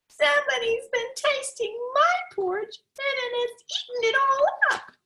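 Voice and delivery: put-on very high, squeaky baby bear; little wee voice. Little wee bear crying that his porridge is gone, very high and squeaky. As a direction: squeak it like the tiniest cub. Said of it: "in his little wee voice".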